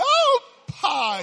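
very high, loud whoop into a smack; imitated slap. Brother Bugs catching someone flying, loud imitated slap. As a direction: whoop loudly, then pop the slap.

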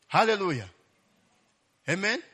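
ordinary talk

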